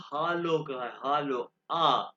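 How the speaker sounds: loud, drawn-out aa in "हालो"; long German A. Shown as a demonstration. Teacher linking the letter A to the greeting, slow demonstration. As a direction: stretch "हालो" on an open ah. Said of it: "ये आ है इसलिए ऊपर".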